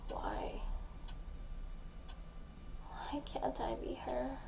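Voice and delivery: hushed, breathy whisper to herself; a whispered ache on a voicemail. Acted. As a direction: barely voice the question, as if afraid to hear it. Tearful late-night voicemail confession.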